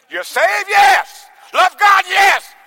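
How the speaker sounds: high, loud preacher calls; preaching call and response. Acted. Preacher calling out to the church, shouted. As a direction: rouse the congregation with shouted questions.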